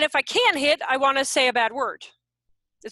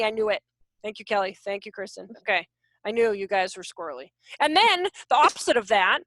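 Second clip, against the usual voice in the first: ordinary delivery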